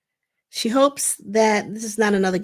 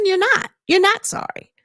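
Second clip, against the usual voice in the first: firm rejection; 10 semitones higher, 4 dB louder than usual. Retold or imitated. Mel rejecting apology.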